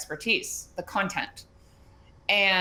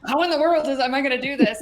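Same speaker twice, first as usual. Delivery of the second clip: anxious client; 3 semitones higher, 4 dB louder, faster than usual. Retold or imitated. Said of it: "They're like".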